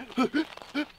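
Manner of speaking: imitated gasping for breath between sobs; sobbing gasp. Crying kid at coach's door, imitated breathless gasps. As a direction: act out a crying kid's hitching breaths. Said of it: "he can't even breathe".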